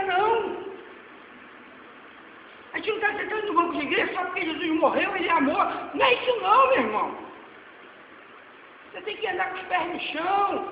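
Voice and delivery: ordinary talk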